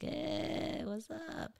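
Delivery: high, quiet stoner greeting; vaping stoner. Retold or imitated. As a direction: squeeze out a soft hello mid-vape. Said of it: "they're just all vaping inside the room".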